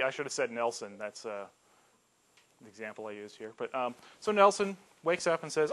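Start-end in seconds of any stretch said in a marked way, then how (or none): none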